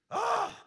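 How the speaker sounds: loud exasperated "ah!"; aggravated groan. Acted. Preacher meeting a detour sign, loud aggravated groan. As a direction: burst out in exasperation. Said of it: "it makes you aggravated".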